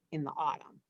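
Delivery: plain reading voice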